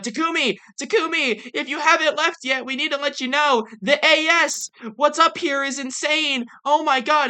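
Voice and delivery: high-pitched frantic message imitation; freaking out. Survivors recording an urgent message to Takumi, freaking out. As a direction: act out panicked survivors calling for Takumi. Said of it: "they're, like, freaking out".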